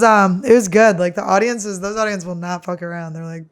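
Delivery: usual speaking voice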